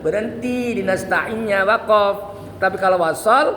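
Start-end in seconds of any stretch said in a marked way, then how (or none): none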